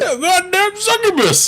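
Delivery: high, slow cartoon character voice; cartoon impression. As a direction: squeeze it up high and drag it out. Quoting South Park line.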